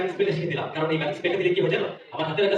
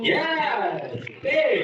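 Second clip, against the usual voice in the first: excited cheer; 5 semitones higher than usual. Real, not acted. Magic trick succeeds.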